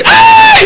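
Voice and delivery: piercing falsetto "ay"; hammed-up yelp of pain; mock shriek. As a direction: shriek in sudden pain, very high and loud. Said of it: "הוא צועק".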